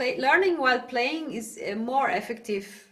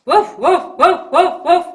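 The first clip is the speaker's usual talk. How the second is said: loud, short chest-voice woofs; steady punchy barks; big dog bark; 5 semitones higher, 11 dB louder than usual. Shown as a demonstration. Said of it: "the big dog".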